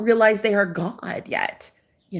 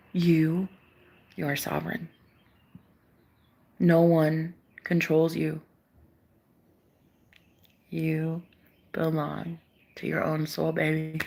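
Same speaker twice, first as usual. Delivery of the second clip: tender; 3 semitones lower, 7 dB quieter than usual. Real, not acted. Soothing the viewer directly.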